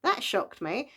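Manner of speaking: high-pitched "oh", then easing down; retold surprise. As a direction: react as if shocked by a twist. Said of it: "and I was like".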